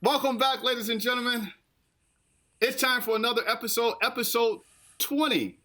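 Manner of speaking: high, upbeat podcast host; excited host voice. Acted. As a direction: welcome them back with energy. Hosts the show opening.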